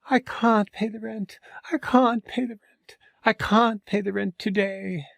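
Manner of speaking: high, slow, pleading damsel voice; damsel in distress. Acted. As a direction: wail like a helpless heroine. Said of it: "the damsel in distress".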